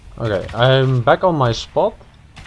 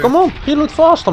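impatient urging. Real, not acted. Teammate slow to reload.

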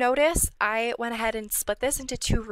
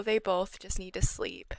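ordinary talk, nothing marked

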